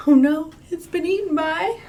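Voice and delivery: mock alarm. Fish gets eaten.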